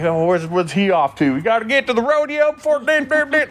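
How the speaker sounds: very high cartoon cowboy Southern accent. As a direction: escalate into a squealing Southern rodeo holler. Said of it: "Yosemite Sam over here".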